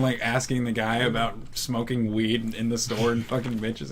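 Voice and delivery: usual speaking voice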